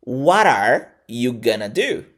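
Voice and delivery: "What are" blended as "wara", "gonna do" slowly; slow repeat-after-me model of "What are you gonna do?"; reduced pronunciation. Shown as a demonstration. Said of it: "repetir exatamente isso aqui".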